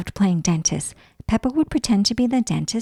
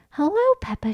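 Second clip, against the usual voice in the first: fairy whisper; 11 semitones higher than usual. Acted. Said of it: "she whispered".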